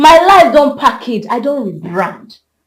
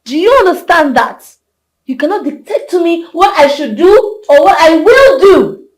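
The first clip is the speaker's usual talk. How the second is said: higher, forceful telling-off; angry assertion; 4 semitones higher, 5 dB louder than usual. Acted. Telling a friend she cannot dictate, angry assertion. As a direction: lay down the law, getting louder. Quarrel over who controls her.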